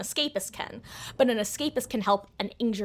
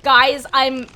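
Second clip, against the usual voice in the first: loud; 5 semitones higher, 11 dB louder than usual. Real, not acted. Scolds herself for forgetting injury.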